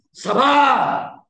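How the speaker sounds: sudden loud shout of Sabar. Acted. Preacher acting a wife losing patience, shouting. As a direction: bark Sabar. out high and hard.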